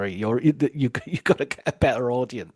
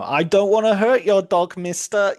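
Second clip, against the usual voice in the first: imitated desperate threat, higher and louder; Catherine Martin; 8 semitones higher, 6 dB louder than usual. Captive threatening her captor's dog, imitated desperate plea. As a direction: call it up like a frightened captive bargaining.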